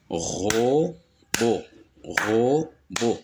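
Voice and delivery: "robot" split into ro, pause, bot; syllable by syllable. Shown as a demonstration. Teacher demonstrating two syllables, syllable by syllable. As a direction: say "robot" as two isolated beats. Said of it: "deux syllabes".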